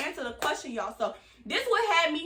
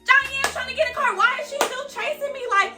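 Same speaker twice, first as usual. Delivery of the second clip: higher, exasperated mock yell; 9 semitones higher than usual. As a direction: yell back in disbelief. Yelling back during the chaos.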